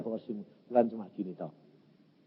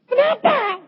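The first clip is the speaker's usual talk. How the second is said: mock cry; 4 dB louder than usual. Acts out his tearful question.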